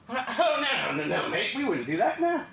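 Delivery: lightly imitated British accent, backing down; British lad. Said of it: "back to the British boys".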